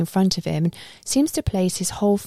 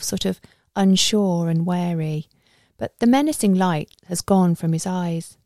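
ordinary delivery

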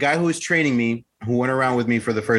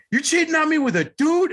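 much higher mock rant of the furious girlfriend; imitating screaming girlfriend. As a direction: squeal her tirade up high, half-joking. Girlfriend accusing on the phone.

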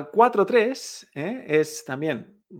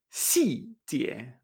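"Xijie" with a sharp drop and a softer lift; falling then rising tones; 3 semitones lower, 5 dB quieter than usual. Shown as a demonstration. Teacher modelling detail, "Xijie" falling then rising. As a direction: let "Xi" fall, then raise "jie". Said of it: "es la misma entonación".

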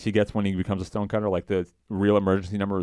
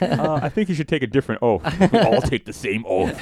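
high, louder put-on voice; imitating Moe; 7 semitones higher, 7 dB louder than usual. Moe objects to the oath, high put-on voice. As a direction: push it up and out like Moe. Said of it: "And Moe's like".

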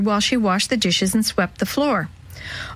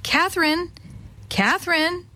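high sing-out of a name; calling out; 8 semitones higher than usual. A narrator's reading. Old woman calls Catherine, high calling voice. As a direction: call it high, as to a child. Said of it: "when the old woman called".

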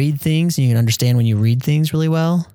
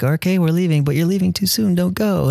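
much higher, sing-song hospitable protest; polite host protest; 8 semitones higher than usual. Retold or imitated. Brazilian host seeing guests out, high sing-song. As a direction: play the gracious host who won't let you go. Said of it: "we're like".

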